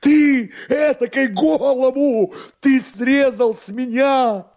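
very high, drawn-out outraged wail; frantic cry. Acted. Master berates his drunken servant, frantic high cry. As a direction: wail it slowly at the top of the voice. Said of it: "закричал господин Галяткин".

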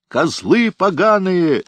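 high, slow hysterical shriek; hysterical scream. A narrator's reading. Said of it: "истерично закричал Шрам".

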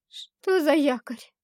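chattering teeth, high and loud, slow. A narrator's reading. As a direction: force it out through chattering teeth. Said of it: "стуча зубами". (стуча зубами от страха)

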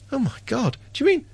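sudden high squeak of mock dismay on do you mean; hammed-up shock at getting what Hong Kong has; mock horror. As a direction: play the Taiwanese aghast at the offer, pitch leaping up. Voicing Taiwanese alarm, mocking.

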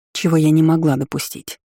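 plain reading voice

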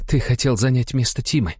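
high, tense woman's voice, climbing; seething accusation. A narrator's reading. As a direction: press each charge with contempt. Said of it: "процедила Алла". (процеживая обвинения)

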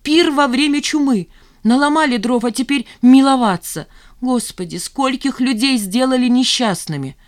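high, loud, anguished reproach; shouting. A narrator's reading. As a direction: keep crying out the reproaches. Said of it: "закричала Жанна". (кричит с горьким упрёком)

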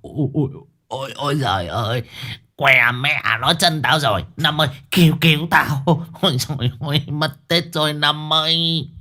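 hammed-up wail of pain; howling in pain. A narrator's reading. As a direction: groan and cry for help over an injured foot. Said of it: "mồm hú như chó sói".

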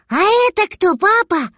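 put-on high, childlike curious dragon; little dragon voice. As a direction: ask like an eager little kid.

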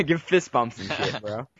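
laughing mock bro voice. Real, not acted. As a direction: echo it through a laugh. Player mocks echoing line laughing.

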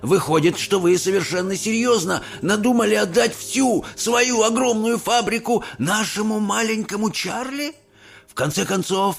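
amazed grandpa asking; old man in disbelief; lightly voiced old grandpa. A narrator's reading. Grandpa Joe voice. (изумлённый дедушка Джо)

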